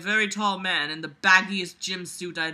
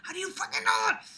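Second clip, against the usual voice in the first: squeaky yelled question; outburst; faster than usual. Pretend. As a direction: burst out in disbelief. Same words yelled after whisper.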